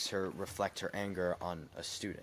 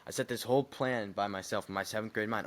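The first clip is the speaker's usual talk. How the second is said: ordinary talk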